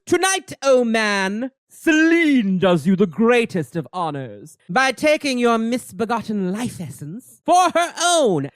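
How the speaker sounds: slow, stately theatrical pronouncement; grandiose villainess. Acted. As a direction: savor each word like a villainess. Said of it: "And then Selene goes".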